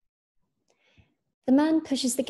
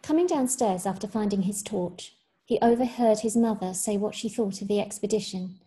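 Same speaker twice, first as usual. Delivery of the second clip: usual speaking voice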